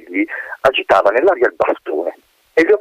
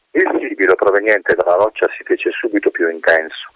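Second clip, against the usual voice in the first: usual speaking voice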